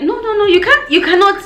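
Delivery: high-pitched rapid protest; retold outraged objection; indignant refusal. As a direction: cut him off, voice shooting up. Acted refusal to police officer.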